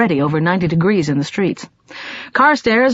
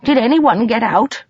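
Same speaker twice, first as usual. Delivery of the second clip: gasped in horror; 5 semitones higher than usual. A narrator's reading. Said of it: "she gasped in horror".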